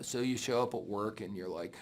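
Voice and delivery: ordinary talk, nothing marked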